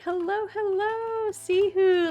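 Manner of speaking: high squeal of welcome; light up for the guests; excited greeting. Real, not acted.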